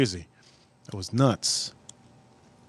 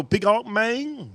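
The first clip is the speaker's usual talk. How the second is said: higher, slow and drawn out; slow imitation of neighbor; 8 semitones higher, 3 dB quieter, much slower than usual. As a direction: stretch it lazily in the neighbor's voice. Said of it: "He go".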